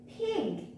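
bare puffed p, then the whole word "pig"; isolated p sound. Shown as a demonstration. Teacher showing children the first sound, isolated p. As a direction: sound out the p before saying "pig". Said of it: "all of these has p sound".

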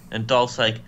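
ordinary talk, nothing marked

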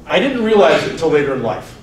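ordinary talk

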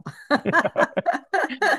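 bright burst of laughter; high, hearty laugh; real laugh. Real, not acted. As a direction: laugh out loud at the joke. Laughing at the language mix-up.

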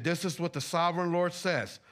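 ordinary talk

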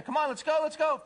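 high-pitched, impatient hurrying along; impatient urging. Retold or imitated. Impatient owner urging cat outside.

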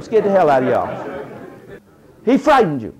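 usual speaking voice

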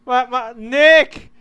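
loud, slow, exasperated nagging; loud scolding. Acted. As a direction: yell at him to go to sleep. Scolding a friend to sleep.

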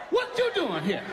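shrill jump high then squeaky laugh; startled waking. Acted. Woke up on a stranger's chest, startled high squeal. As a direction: jolt awake shocked and laughing.

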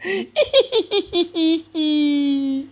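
put-on high, far-off girlish cackle; high mocking giggle. Reader giggling as the three mocking friends. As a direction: giggle like a cheeky schoolgirl. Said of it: "elles ont ri".

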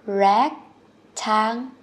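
"Rectangle" slowly, with REC punched; stress on first syllable. Shown as a demonstration. Teacher shows learners where stress falls, "Rectangle" slow with stressed REC. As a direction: lean hard on the "Rec" of "Rectangle", syllables spaced out. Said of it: "Nhấn âm một nhá".

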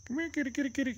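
very high, quick repeated pet call; high kitty call. Real, not acted. Owner calling the cat over, high-pitched coaxing.

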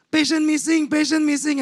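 high-pitched urgent alert, called out; alarmed hospital staff. Retold or imitated. Nurses raising commotion over a missing patient, high alarmed call. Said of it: "outside there's a commotion".

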